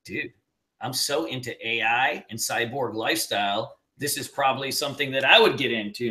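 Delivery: low, hushed dude voice; mock-awed student, dropped low and quiet; imitating his student. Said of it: "he's like".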